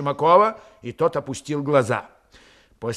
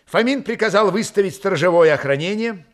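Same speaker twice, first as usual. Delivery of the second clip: usual speaking voice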